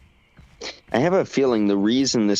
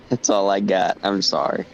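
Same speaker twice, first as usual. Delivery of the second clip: usual speaking voice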